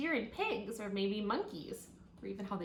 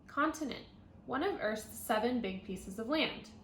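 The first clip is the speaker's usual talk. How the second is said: bright, clear read-aloud voice; reading to children; 2 semitones higher, 5 dB louder than usual. Acted. Teacher reading a tiger book to her class, clear read-aloud.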